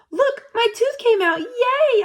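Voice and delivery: put-on high, loud cheering kid; excited child.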